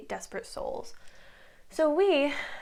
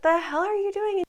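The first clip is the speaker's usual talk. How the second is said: mock angry Regina; 8 semitones higher, 5 dB louder than usual. Regina catches them in tomb.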